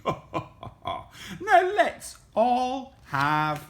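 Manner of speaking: high, slow granny voice; Granny character. A narrator's reading. Granny inviting everyone to eat, slow high voice.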